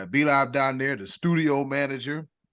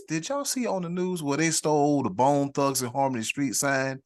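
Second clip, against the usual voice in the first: ordinary talk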